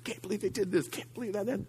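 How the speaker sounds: a hint of sulky muttering; muttered self-pity. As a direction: mutter it to yourself, stewing. Voicing bitter self-pity.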